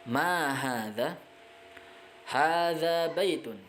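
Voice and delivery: Ma hadza rising slowly, then Hadza baitun falling; teacher drilling Arabic question and answer. Shown as a demonstration. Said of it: "itu pernyataan... membuat pertanyaannya".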